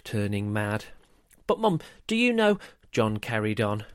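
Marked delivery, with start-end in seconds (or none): 1.5-2.6 put-on high, quick child's voice; persistent little boy. A narrator's reading. John carrying on at busy Mum, quick high pestering. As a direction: press on fast and high with the question.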